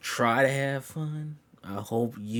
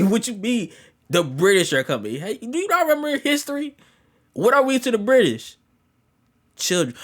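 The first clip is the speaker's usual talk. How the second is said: loud, high, exasperated mock lecture; high-pitched exasperation; 11 semitones higher, 6 dB louder than usual. Explaining the British code to Hope, high-pitched and exasperated. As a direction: push it up high in comic disbelief.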